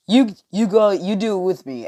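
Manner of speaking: worked-up insistence; insistent order. Acted. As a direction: order it loudly, tripping on the start. Acted insisting while pushing him down.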